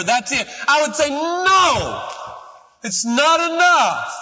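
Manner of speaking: shouted preaching. Acted. Preacher telling the congregation he wants to see God, shouted preaching. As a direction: cry out your longing to the congregation.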